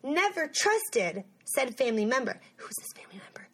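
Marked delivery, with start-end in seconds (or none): 2.6-3.5 hushed, whispered question; whispered aside. Pretend. Gossipy aside about unnamed suspect.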